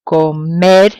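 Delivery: comer, punching MER; final-syllable stress. Shown as a demonstration. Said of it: "stress will fall on the last syllable".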